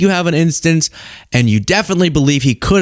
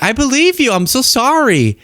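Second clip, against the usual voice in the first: mock sympathy; 10 semitones higher than usual. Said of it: "And I'm like".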